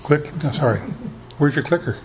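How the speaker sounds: normal talking voice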